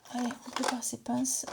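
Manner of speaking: unvoiced whisper, getting to work; soft whispered self-encouragement; whispered to herself. Real, not acted. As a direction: whisper it like a private cue. Talking to herself while working.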